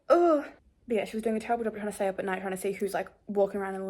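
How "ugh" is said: high, loud "ugh" of fright; imitated startled noise. Vlogger re-enacting her reaction downstairs, startled outburst. Just as I was like.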